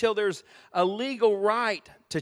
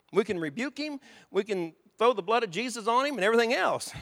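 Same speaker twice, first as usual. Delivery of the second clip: ordinary delivery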